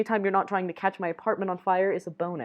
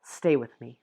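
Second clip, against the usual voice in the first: ordinary delivery, nothing marked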